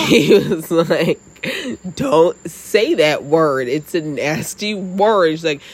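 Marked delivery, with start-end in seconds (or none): 2.0-5.3 slow, drawn-out mock telling-off; retold exasperated scolding. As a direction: imitate an appalled dad, stretching each word. Retold dad scolding, escalating.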